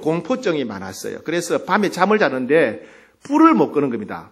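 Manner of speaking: ordinary talk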